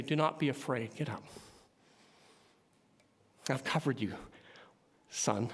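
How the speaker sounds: soft, low and gentle; tender reassurance. Acted. Jesus reassuring a fallen servant, softly and tenderly. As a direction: speak it like lifting up a frightened son.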